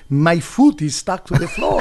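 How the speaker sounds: hammed-up Spanish accent, a bit vampire-like. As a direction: imitate a stranded crooner in a thick Spanish accent. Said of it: "does he always sound like a vampire?".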